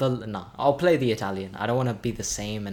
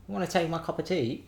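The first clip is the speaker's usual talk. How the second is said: put-on English accent; 6 semitones higher than usual. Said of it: "we're done with the cringe".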